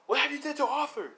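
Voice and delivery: high-pitched demanding cry; acted confrontation. Deku confronting the Gremlin about Arthur, high demand. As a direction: demand it like an alarmed hero.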